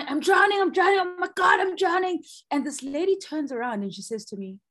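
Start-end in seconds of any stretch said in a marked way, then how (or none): 0.0-2.2 high, loud hammed-up panic cry; mock panic. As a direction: act out thrashing in the water as if drowning. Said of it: "in the midst of dramatics".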